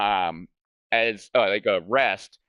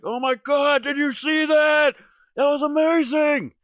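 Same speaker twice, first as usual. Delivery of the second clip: high, louder excited shout; freaking out; 4 dB louder than usual. Retold or imitated. Son and dad after the elk falls, high excited shout. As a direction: yell it like you just saw the shot drop. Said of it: "freaking the hell out".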